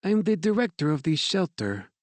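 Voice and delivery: a gracious French director's greeting; French accent. A narrator's reading. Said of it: "her English marked with a French accent".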